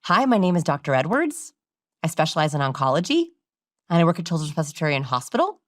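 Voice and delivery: every phrase ends on an unsure rise, "Hospital" lifted like a question; question inflection. Shown as a demonstration. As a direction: sound like a doubtful bad doctor. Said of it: "the bad doctors sounded like this".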